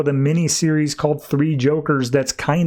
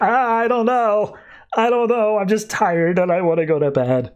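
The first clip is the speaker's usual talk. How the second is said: mock crying; 7 semitones higher than usual. Joe answering his cellmate from the floor, mock crying. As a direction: imitate a tearful, worn-out man. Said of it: "Why are you crying on the floor?".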